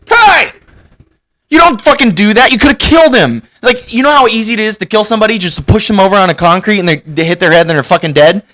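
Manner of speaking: put-on yelling high and loud at a kid who pushed someone; furious shout. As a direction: chew him out full blast, scared and angry. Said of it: "I've been like".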